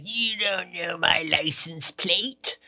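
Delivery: slow, drawn-out mock drunk voice; imitated drunk heckler. Smashed woman challenging the mentalist on stage, slow mock slur. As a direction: stretch every word in mocking imitation. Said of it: "then she's like".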